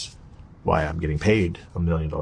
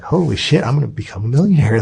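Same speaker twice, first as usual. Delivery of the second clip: retold burst of excited disbelief, pitched up; mock-stunned, excited shout; 5 semitones higher, 4 dB louder than usual. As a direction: relive the thrill of hearing you'll be rich. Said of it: "I'm so excited now".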